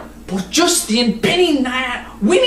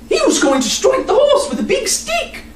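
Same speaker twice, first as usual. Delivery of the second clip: put-on high child's indignant voice; little girl tattling. Nan adds.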